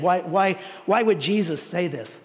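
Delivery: usual speaking voice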